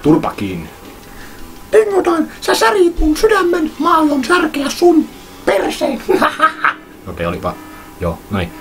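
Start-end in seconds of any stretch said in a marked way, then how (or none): 1.7-6.0 very high, squeaky mock-wounded voice, slow at the end; squeaky heartbroken voice. Särkynyt sydän, kosto Dingodilelle. 6.1-6.8 very high squeaky cartoon giggle. Kimeän äänen jälkeen.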